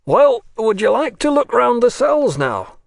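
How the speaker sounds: a loud official waking a dozing visitor; the inspector. A narrator's reading. Inspector wakes sleeping Nekhludoff.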